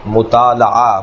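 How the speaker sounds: مطالعہ as mu-taa-la-ah, each syllable sounded; correct pronunciation. Shown as a demonstration. Teacher modelling the word pattern, correct pronunciation of مطالعہ with "la". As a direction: keep a clear "la" in مطالعہ. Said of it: "صحیح تلفظ ہے مطالعہ".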